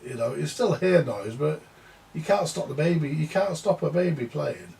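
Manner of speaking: ordinary delivery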